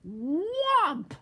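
high, loud swooping "whomp". A narrator's reading. Storyteller sounds Bear's paw landing, loud whomp. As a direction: slam it out like a heavy blow.

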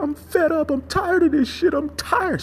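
very high, shrill fed-up outburst; shrill anger. Retold or imitated. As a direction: screech it like an exasperated Karen. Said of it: "she, the Karen, pulled over and just went to the police station and said".

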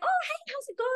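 chirpy high-pitched friend who acts like nothing's wrong; airy sweet Jane. Retold or imitated. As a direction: play her as sugary and unbothered. Jane's airy sweet reply.

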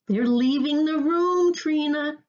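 high, drawn-out call; shouting. A narrator's reading. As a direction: call urgently to Trina, stretching the words. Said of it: "shouted Dad".

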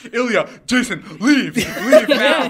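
high, drawn-out plea to leave; mock desperate order. Tripping friend ordering friends out, mock desperate. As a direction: imitate a tripping friend's urgent command.

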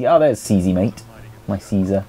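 ordinary talk, nothing marked

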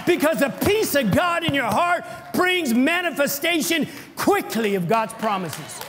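high, loud, slow preacher's cry; preaching at full force. Acted. Preacher stirring up the congregation, preaching at full force. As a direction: thunder it out to the church.

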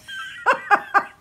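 high breathy giggle. Real, not acted. Admitting to viewers she owns many stamps, giggling. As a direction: let a quick real giggle slip out.

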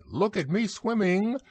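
high, squeaky little boast; bubbling proudly. A narrator's reading. Roo drifts against the pole, bubbling proudly in a high squeak. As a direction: pipe it up proudly. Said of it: "still bubbling proudly".

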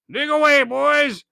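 lightly voiced, coolly insolent; cool insolence. Silver coolly taunting the digging pirates. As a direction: toss it off with contempt. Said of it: "with the coolest insolence".

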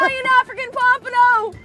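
high, elated yell; excited shouting. Real, not acted. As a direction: announce the prize catch in excitement. Celebrating friend's giant catch.

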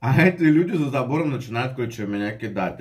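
speaking between bites; talking while chewing. Real, not acted. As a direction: mouth partly full of pasta. Seen 2: Bite of pasta at -1.0; mouth seems partly full while talking.

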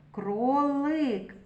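Кролик with a long held и; drawn-out И. Shown as a demonstration. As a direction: stretch the и in Кролик to single it out. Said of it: "Звук И голосний".

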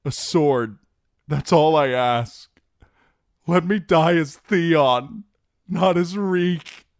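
high, loud, desperate begging; tearful pleading. A narrator's reading. Theon begs to die as himself, tearful pleading. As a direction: cry it out to the gods, rising in pitch. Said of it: "Tears trickled down his cheeks".

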